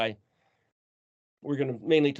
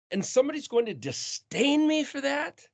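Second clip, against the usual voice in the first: incredulous question; 9 semitones higher than usual. Real, not acted. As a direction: pitch shoots up on "me" in disbelief. Preacher incredulous at being disdained.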